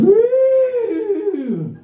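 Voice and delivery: high piercing mock squeal of the car; imitated car squeal. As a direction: squeal like a worn belt, way up high. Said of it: "car goes".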